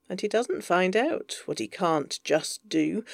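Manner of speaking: ordinary delivery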